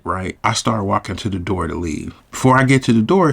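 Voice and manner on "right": normal talking voice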